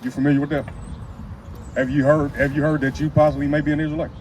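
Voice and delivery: usual speaking voice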